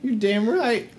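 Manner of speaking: very high, indignant character voice; halfling woman. Acted. Halfling woman confirming which bird was hers, high and indignant. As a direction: snap back in a high put-on voice. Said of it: "a halfling woman".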